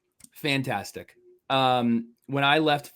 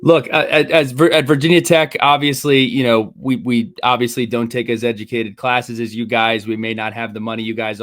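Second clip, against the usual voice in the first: sarcastic mock humility; 2 semitones lower, 8 dB louder than usual. Mock self-deprecation about rival school.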